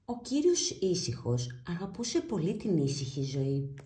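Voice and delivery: ordinary delivery, nothing marked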